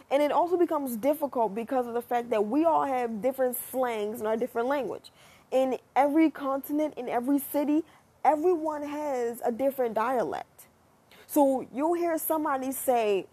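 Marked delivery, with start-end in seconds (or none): none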